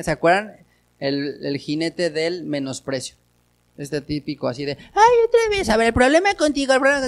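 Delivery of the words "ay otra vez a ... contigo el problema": much higher, whiny mocking voice; mocking imitation of wife. Husband mocking his wife's complaint, in a high whiny imitation. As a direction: mimic her nagging in a high sneer. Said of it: "así de imitándola".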